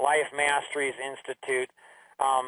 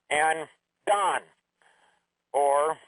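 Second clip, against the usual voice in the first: usual speaking voice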